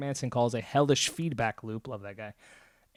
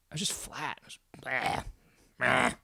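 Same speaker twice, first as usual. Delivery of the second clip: lifeless monotone; 4 dB quieter than usual. Shown as a demonstration. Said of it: "tonality and inflection in my voice".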